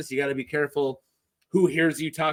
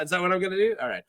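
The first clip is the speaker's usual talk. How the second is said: plain reading voice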